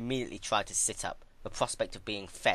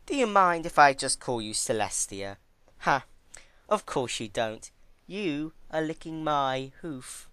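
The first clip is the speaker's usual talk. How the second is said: Twilight gloating; 7 semitones higher, 5 dB louder than usual. Acted. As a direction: savour your power over her. Twilight mocking.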